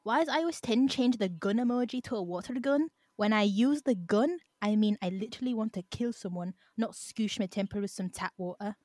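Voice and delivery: hammy attempt at a Scottish accent; bad Scottish accent. Shown as a demonstration. Podcast host reads a slang example to friends, bad Scottish accent. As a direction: try a Scottish accent, badly. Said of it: "try and say it in a Scottish accent".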